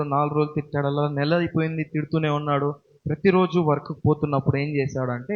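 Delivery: normal talking voice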